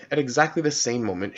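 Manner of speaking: usual speaking voice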